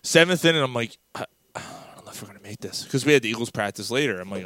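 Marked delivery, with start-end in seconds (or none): 1.2-2.8 quick hushed mutter, barely voiced; retold exhausted whisper; worn-out whisper. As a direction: whisper it quickly, as if running out of steam. Retells being spent at seventh inning.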